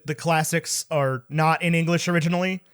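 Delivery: normal talking voice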